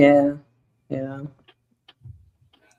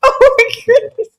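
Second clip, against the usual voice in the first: laughing; 11 semitones higher, 6 dB louder than usual. Real, not acted. Laughing at the quote.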